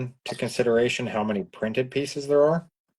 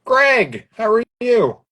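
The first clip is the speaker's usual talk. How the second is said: much higher and louder, calling out; loud cheery greeting; 11 semitones higher, 6 dB louder, slower than usual. Real, not acted. Host greeting Greg loudly and brightly. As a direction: hail him across the room.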